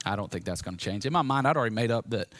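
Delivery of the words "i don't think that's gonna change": low, soft retold shrug; dismissive resignation. Son privately doubting his dad will change, low dismissive resignation. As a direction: mutter it under the breath, unconvinced. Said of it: "this is really where my heart was at".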